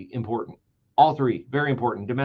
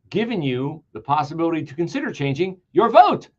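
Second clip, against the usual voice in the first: ordinary talk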